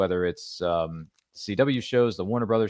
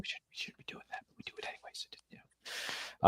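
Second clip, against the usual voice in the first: hushed conspiratorial whisper, much softer; secretive whisper; 13 dB quieter than usual. Acted. Host confiding to guest about sneaking late-night TV, in a secretive whisper. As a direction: lean in and whisper it like a shared secret. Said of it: "keep it on the down low".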